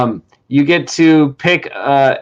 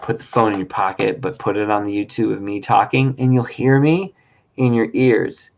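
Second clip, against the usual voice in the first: mock creepy; 6 semitones lower, 6 dB quieter than usual. Host repeating instructions to the guest in a mock creepy hush. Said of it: "I was trying to be creepy".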